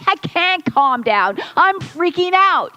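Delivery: mock freaking out. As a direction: play the panicked person snapping back. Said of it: "freaking out in the moment".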